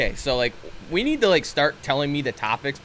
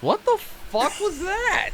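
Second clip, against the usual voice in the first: incredulous; 13 semitones higher, 5 dB quieter, slower than usual. Real, not acted. Incredulous about the song.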